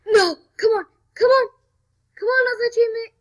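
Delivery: loud, high, fast pleading; urgent pleading. Real, not acted. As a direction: urge them on desperately. Begging teammate while being eaten.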